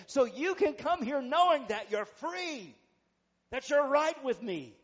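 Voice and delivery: loud, emphatic preacher's delivery; full-force preaching. Real, not acted. Preacher telling guilty churchgoers they are free, forceful preaching.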